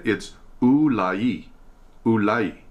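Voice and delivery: Ulai said slowly, syllable by syllable: oo-LAI; careful pronunciation. Shown as a demonstration. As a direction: spell out Ulai as oo-lai, with a falling end. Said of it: "this is actually the way to pronounce it".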